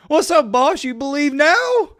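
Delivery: smug taunt at the doubting reporter; imitating Deion. Vlogger mimics Deion taunting the doubting reporter, cocky. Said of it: "Deion Sanders locked eyes with him and said".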